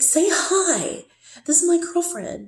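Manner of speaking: put-on proud boyfriend bragging, voice up high; excited introduction. Boyfriend introducing his girlfriend on video, excitedly. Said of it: "And he's like".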